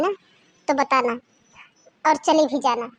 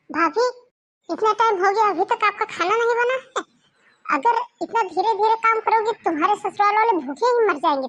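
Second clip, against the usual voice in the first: scolding; 6 semitones higher, 3 dB louder than usual. Acted. Sister-in-law scolds slow bride.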